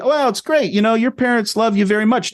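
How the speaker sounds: put-on high, eager cowboy voice gushing over the kid; a theme park worker really going for it, high and eager; excited cowboy statue. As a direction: play the statue going all in, pitched high. Worker voicing Sad Eye Joe.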